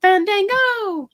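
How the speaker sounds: "Fandango!" sung out high and loud; exclaimed Fandango. Pretend. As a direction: belt out "Fandango!" with a stretched, rising start. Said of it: "I always wanna say Fan-, Fandango".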